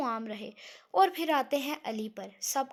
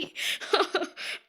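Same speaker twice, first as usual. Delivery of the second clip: real laugh; 6 semitones higher, 3 dB louder than usual. Real, not acted. Laughs after calling viewers sister/brother.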